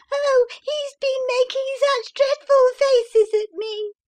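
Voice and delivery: put-on very high, little boy crying out; child's wail. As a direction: wail like a terrified small boy. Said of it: "cried the boy".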